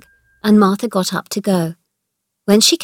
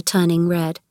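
plain reading voice

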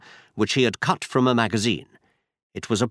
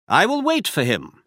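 brisk, higher-pitched detective voice; Holmes voiced higher; 8 semitones higher than usual. A narrator's reading. Holmes tells Watson where they go, higher detective voice. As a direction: voice Holmes up high, assured.

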